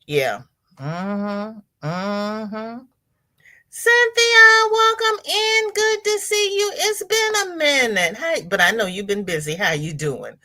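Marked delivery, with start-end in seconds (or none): 3.7-8.2 singsong welcome. Real, not acted. Host welcoming Cynthia back after a while, high singsong. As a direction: sing out a high excited hello.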